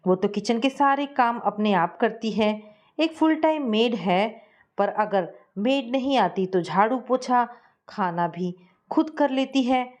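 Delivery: usual speaking voice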